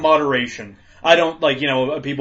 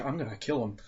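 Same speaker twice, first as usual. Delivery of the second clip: muttered threat; 2 semitones lower, 13 dB quieter than usual. Retold or imitated. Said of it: "And I'm like".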